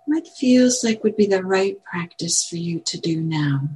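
calm, spacious meditation guidance; guided meditation. Acted. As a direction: guide gently with long pauses. Leads a meditation.

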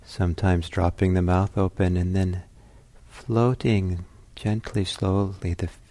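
measured meditation guidance with long pauses; calm, unhurried meditation teacher; guided meditation. Real, not acted. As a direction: ease listeners into stillness, leaving space between phrases.

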